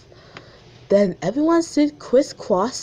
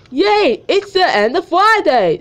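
put-on high, loud cheering kid voice; excited cartoon boy, much higher and louder; Gumball voice; 8 semitones higher, 5 dB louder than usual. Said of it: "that's my voice for Gumball".

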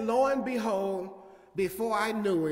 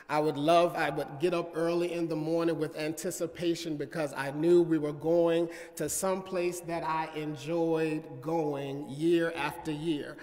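ordinary talk, nothing marked

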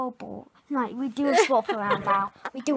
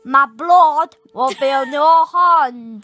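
loud put-on northern voice; northern accent; 13 dB louder than usual. Shown as a demonstration. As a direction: belt it out in a mock northern accent. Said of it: "was that not northern?".

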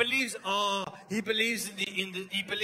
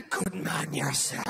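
low, slow priest voice; priest behind the wall; 6 semitones lower than usual. Acted. Priest to child through confession wall, low and slow. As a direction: drop low and draw it out slowly. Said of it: "on the other side of the wall".

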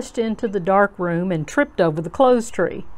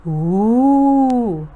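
long, loud, higher ghostly "wooo"; drawn-out eerie wail; spooky cave wail; 4 semitones higher, 5 dB louder than usual. A narrator's reading. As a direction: howl theatrically like a crying cave. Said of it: "read Brother with a lot of expression".

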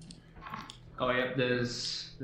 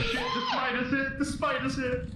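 panicked shout; 13 semitones higher, 4 dB louder than usual. Real, not acted. Spider chasing them.

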